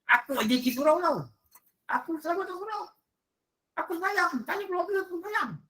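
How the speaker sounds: high, fast whiny voice of a soul at judgement; pleading dead soul. Retold or imitated. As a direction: plead high and quick like a frightened ghost. Voicing a pleading dead man.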